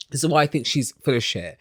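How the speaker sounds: ordinary talk, nothing marked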